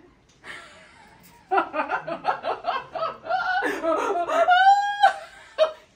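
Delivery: long high shouting cackle; loud laugh. Acted. The hidden thief laughing loudly in the forest.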